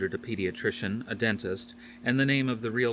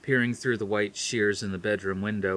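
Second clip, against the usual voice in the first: plain reading voice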